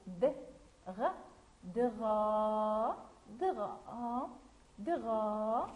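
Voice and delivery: teacher sounding out DRA-, vowel held long; broken into sounds. Shown as a demonstration. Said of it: "on dit d'abord".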